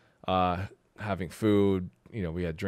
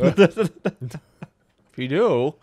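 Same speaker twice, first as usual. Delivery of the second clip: hammy ad voice; 9 semitones higher, 10 dB louder, much slower than usual. Acted. Mock ad pitch.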